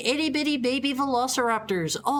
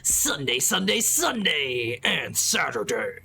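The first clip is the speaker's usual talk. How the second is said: deep, drawn-out hype announcer; fighting game announcer; 7 semitones lower, slower than usual. Pretend. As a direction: boom it out like an arena announcer. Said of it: "That's my announcer voice".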